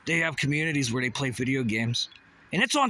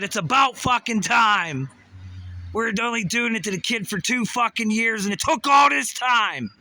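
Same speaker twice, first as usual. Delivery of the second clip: mock angry quote; 6 semitones higher, 5 dB louder than usual. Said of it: "they said".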